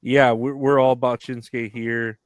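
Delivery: normal talking voice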